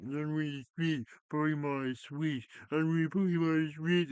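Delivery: high, loud, whiny mock crybaby voice, the last line loudest; mock crying fan. Mocking crying fans who begged for a Switch, high whiny wail. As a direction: wail it like a fan throwing a tantrum. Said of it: "you crying like".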